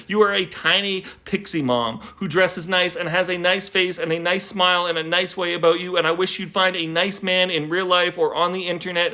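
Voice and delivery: earnest spoken-word poem to his mother; poem reading. Acted. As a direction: pour it out to mom in one long breath. Reads poem for mom.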